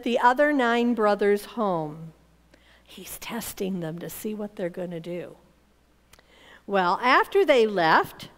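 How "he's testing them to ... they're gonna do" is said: hushed, near-whispered aside; low, half-whispered explanation; confiding aside. A narrator's reading. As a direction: let the listeners in on a secret, very softly. Narrator's confiding aside to listeners.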